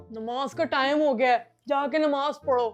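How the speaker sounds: very soft nudge to get up; hushed prompting. Acted. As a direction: murmur it to a sleeper. Devil quietly wakes boy for prayer.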